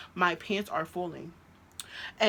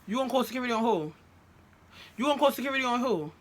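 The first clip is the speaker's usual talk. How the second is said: fast, higher-pitched challenge; retold indignant challenge; 5 semitones higher, faster than usual. Girlfriend challenging his threat to call security, indignant. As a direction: throw the threat back at him, quick.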